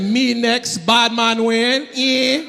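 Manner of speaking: slow, drawn-out patois name; mock Jamaican bad man. Comic replays his persona in a slow mock patois. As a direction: lay on the Jamaican patois, slowly. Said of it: "I said".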